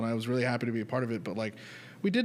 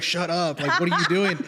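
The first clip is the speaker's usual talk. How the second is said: louder, higher, laughing hiss of alarm; mock exasperated scold; 5 semitones higher, 6 dB louder than usual. Friends scolding Santiago, retold.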